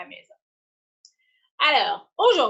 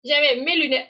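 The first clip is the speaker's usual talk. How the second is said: ordinary talk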